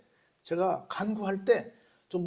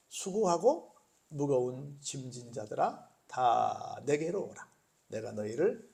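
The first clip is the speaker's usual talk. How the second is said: slow, lower and softer words of the Lord; scripture quotation; 5 semitones lower, 4 dB quieter, slower than usual. Acted. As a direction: quote the invitation slowly and gently. (느리고 낮게 주님의 말씀을 읽듯이)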